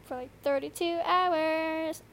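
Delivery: tired, slow drawl; exhausted. Real, not acted. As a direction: sound worn out from no sleep. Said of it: "I'm exhausted".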